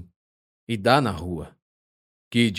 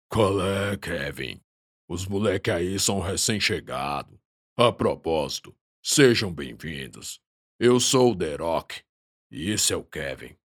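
deep chesty voice; 3 semitones lower than usual. Acted. The Rock speaks, voiced big man.

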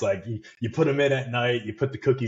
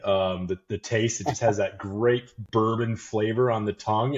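usual speaking voice

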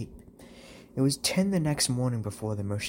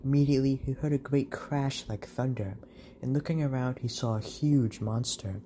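ordinary delivery

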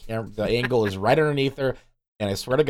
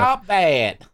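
higher, louder Southern drawl scolding; 7 semitones higher, 5 dB louder than usual. Acted. Cohost playing Rogue scolding Magneto, Southern scold. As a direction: snap it like a Southern auntie.

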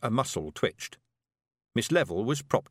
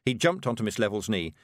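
usual speaking voice

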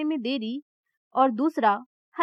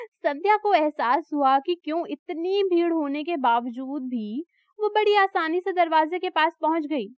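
ordinary delivery, nothing marked

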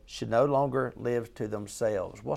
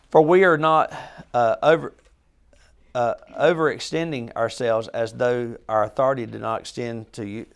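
reading the verse out, loud at first, then settling; scripture reading; 6 dB louder than usual. Acted. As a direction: proclaim the verse to the group. Reads scripture aloud.

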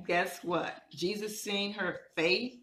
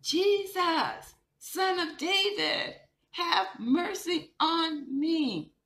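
much higher, louder, slow desperate cry; yelling out; 11 semitones higher, 5 dB louder, slower than usual. Retold or imitated. Blind man yelling again to Jesus, high, loud and slow. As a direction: yell it out over the crowd. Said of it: "Bartimaeus yelled out again".

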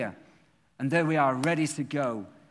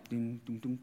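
hummed guitar intro; 3 semitones lower, 6 dB quieter than usual. Retold or imitated.

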